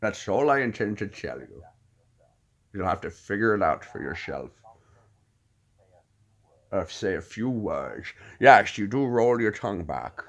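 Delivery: soft, deliberate, slurred-s delivery; Alfred Hitchcock. Acted. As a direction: roll the tongue back and pronounce it gravely. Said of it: "Let me hear the voice".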